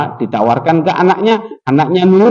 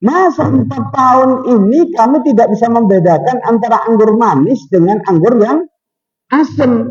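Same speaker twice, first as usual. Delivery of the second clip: angry boss; 6 semitones higher than usual. Retold or imitated. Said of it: "bosnya ini marah".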